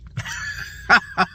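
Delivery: high, voiceless burst of laughter. Real, not acted. Voter faced with waiting for results, laughing. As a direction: laugh mostly on breath.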